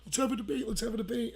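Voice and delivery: high, insistent imitation of a nag; mock pestering. Girl at a party badgers a debater, mock pestering. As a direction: imitate someone nagging on and on. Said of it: "she's just going".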